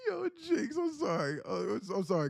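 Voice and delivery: high-pitched words squeezed through laughter; real giggling squeal; laughing apology. Real, not acted. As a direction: apologize while still cracking up. Apologizing while helpless with laughter.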